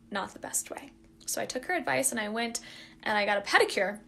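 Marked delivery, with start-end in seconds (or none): 0.1-2.6 whispered aside. Real, not acted. Aside while narrating.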